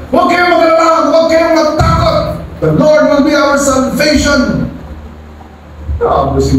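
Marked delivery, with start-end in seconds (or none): 0.1-4.6 high, fast, urgent reassurance; rally a frightened crowd quickly; Moses reassuring the people. Acted. Moses stands up to calm the people, high and urgent. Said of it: "Moses stood up muna".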